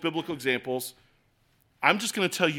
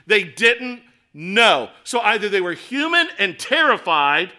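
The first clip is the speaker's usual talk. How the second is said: preaching at full force; 5 semitones higher, 7 dB louder than usual. Acted. Pastor pressing the congregation about the fiery furnace, loud and driving. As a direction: preach it hard to the back row.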